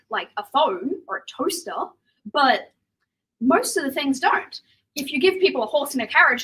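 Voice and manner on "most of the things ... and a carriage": ordinary talk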